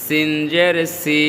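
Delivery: 'সি' with a fine, sharp s; thin S. Shown as a demonstration. Teacher repeats for learners, 'সি' with a thin s. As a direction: say 'সিন' and 'সি' with thin s, never sh. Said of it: "চিকন হবে".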